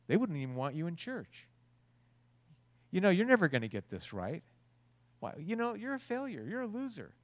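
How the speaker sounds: lightly voiced devil needling you to quit; tempter's voice. The little devil on your shoulder, lightly voiced, mocking. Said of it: "He's saying".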